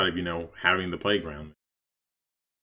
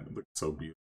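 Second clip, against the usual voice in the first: much softer, tender awe; soft admiring; 6 semitones lower, 13 dB quieter, faster than usual. Real, not acted. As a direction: breathe it out in admiration. Admiring own fountains.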